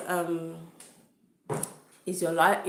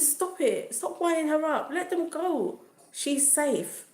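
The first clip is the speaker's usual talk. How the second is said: high-pitched, insistent scolding; high exasperated plea; retold exasperated telling-off; 9 semitones higher, slower than usual. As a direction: re-enact telling a meddler to stop, pitch right up. Retold scolding of meddling woman.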